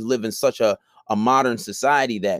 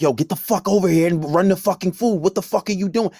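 imitating yelling manager; 8 semitones higher than usual. Retelling manager yelling at staff.

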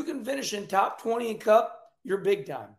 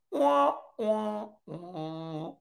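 mock crybaby wailing; 4 dB quieter, much slower than usual. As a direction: imitate whining fans with fake sobs. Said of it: "the fans are like".

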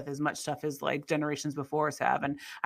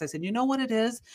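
ordinary talk

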